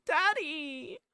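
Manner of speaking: high-pitched mock child's scream; screaming kid. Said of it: "Cut to the kid screaming".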